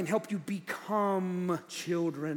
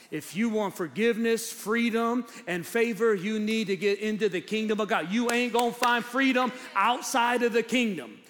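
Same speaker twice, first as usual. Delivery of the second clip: emphatic, raised preacher's voice; forceful preaching; 5 semitones higher, 6 dB louder than usual. Real, not acted. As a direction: drive each promise home to the congregation.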